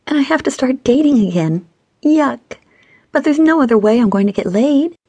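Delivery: high-pitched woman's complaint, mock pouting; pouty, whining friend. A narrator's reading. Stacy griping to her friend, pouty whining. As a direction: whine playfully in a high voice.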